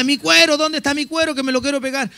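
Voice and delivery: frantic, voice jumping up over the lost fingertip; panicked cry. Retold or imitated. Man with a cut finger begging for his skin, panicked.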